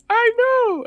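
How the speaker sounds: put-on very high, squeaky kid voice; hammed-up child whine; mock whiny kid. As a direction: squeak it like a sulky little boy. Voicing the boy sadly.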